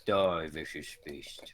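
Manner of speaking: slow, low and soft, as if asleep; sleep talking. Acted. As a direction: vow revenge drowsily in your sleep. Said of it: "I say in my sleep".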